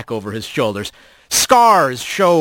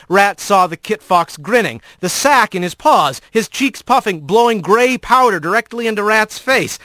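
plain reading voice

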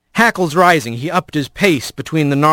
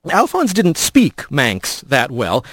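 usual speaking voice

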